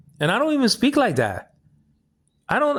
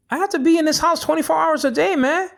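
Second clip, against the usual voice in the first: higher, insistent retelling; pushing the point up in pitch; raised retold protest; 9 semitones higher than usual. Explaining to a neighbor why he's always home, higher and insistent.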